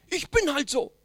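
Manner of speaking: loud, defiant shrug of a line; stubborn self-justifying tone; mock defiance. Acted defiant excuse. (trotzig gespieltes „Ich bin halt so“, laut)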